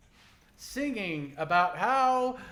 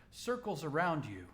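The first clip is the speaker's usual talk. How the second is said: ordinary delivery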